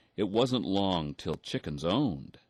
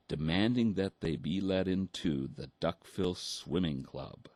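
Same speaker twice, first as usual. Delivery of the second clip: ordinary delivery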